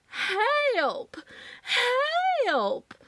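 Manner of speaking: high, drawn-out Southern cry for help; southern-accented scream. A narrator's reading. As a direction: wail it like a panicked Southern lady. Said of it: "she screamed in her very southern accent".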